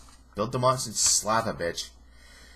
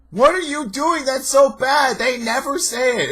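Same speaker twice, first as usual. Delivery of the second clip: exasperated shout; 13 semitones higher, 7 dB louder than usual. Real, not acted. Reviewer ranting that the show never says it, exasperated high shout.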